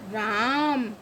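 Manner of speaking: राम with a drawn-out aa; long vowel. Shown as a demonstration. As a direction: stretch राम on the aa. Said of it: "ऐसे पढ़ते हैं".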